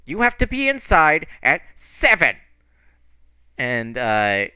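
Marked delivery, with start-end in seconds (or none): 0.1-2.4 high, loud scolding woman's voice; scolding lady. Acted. Lady scolds the kid over curfew, loud and exasperated. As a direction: bellow it like a fed-up matron. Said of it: "thick lady at the opposite end, and she's like".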